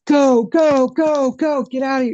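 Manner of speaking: loud, high-pitched yelled command; retold urgent yell. Dad ordering his child out of the truck, urgent yell. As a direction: imitate a panicked dad yelling to get out. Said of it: "he yells, he's like".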